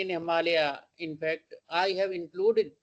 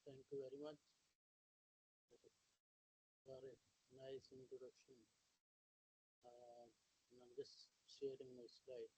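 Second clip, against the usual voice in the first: faint, far-off voice from a weak microphone; barely audible; 5 semitones lower, 30 dB quieter than usual. Real, not acted. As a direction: speak as if far from the mic, barely heard. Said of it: "you're not very audible".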